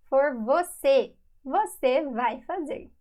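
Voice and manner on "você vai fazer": ordinary delivery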